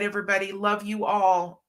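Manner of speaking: ordinary talk, nothing marked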